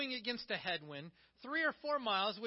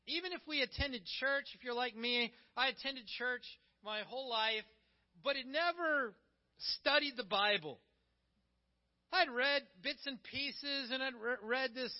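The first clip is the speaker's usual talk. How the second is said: ordinary delivery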